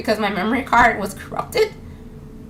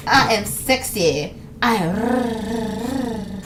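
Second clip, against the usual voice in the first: mock sexy gamer; much slower than usual.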